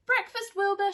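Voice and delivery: high, loud call to breakfast. Acted. Winnie calls her cat down to breakfast, a high loud call. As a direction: call through the house. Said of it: "she called".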